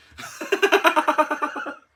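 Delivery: loud, high burst of laughter; loud real laugh. Real, not acted. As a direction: crack up laughing. Laughing at her own story.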